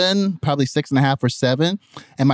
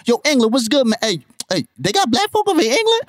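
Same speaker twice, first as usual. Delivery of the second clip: high, incredulous mock kid voice; squeaky-high comic questioning; American kids' voices; 10 semitones higher than usual. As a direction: imitate a kid astonished by the newcomer. Acts out kids questioning English newcomer.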